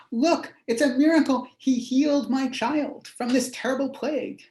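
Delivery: a thrilled woman showing off her miracle; excited woman. Acted. Woman telling the whole town, excited and high.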